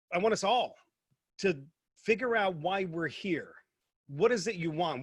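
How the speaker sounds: ordinary talk, nothing marked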